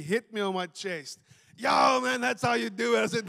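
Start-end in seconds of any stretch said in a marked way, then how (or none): 1.6-3.0 high, loud congratulation; imitating an excited gym buddy; pumped-up excitement. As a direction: whoop it like a thrilled spotter. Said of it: "he was so excited. He was pumped".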